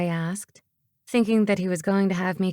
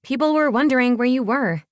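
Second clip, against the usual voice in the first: put-on high, louder girl's complaint; indignant higher voice; demanding; 5 semitones higher, 5 dB louder than usual. A narrator's reading. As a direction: call him to account, pitched up. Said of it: "she demanded".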